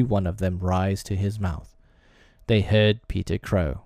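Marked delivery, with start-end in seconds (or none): none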